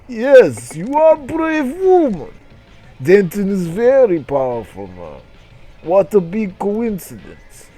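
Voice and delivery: put-on high, loud accented alien block voice; mock Russian-accented block character boasting. Block character speaks to Christy.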